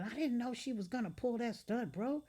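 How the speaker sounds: mild mock yell on the phone. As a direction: yell back defensively. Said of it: "I hear Cheetah yelling".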